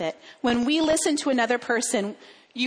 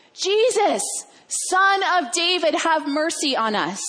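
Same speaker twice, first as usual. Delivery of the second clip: high, raised pleading call; drawn-out cry for mercy, pitched up; yelling out; 5 semitones higher than usual. Acted. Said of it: "they're yelling out to Jesus".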